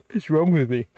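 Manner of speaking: stretched by a yawn. Real, not acted. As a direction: talk mid-yawn. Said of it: "I wasn't yawning before".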